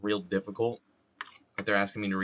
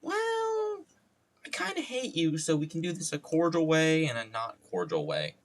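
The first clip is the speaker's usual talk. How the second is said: mock hostile ex; 8 semitones higher than usual. One ex telling the other off, high mock hostility. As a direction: squeal the opening, then come down. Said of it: "the other one's like".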